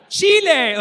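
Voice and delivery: very high, loud shouted name; mock concert shout; stage shout. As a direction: yell it to a stadium. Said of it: "And then he's like".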